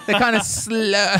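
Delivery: high, slowed mocking voice; teasing mock slow voice. Friend teasing about Latvians, a high slowed mock voice. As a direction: play dumb, drawn out and high.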